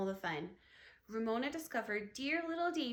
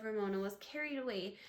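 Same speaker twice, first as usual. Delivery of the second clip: ordinary delivery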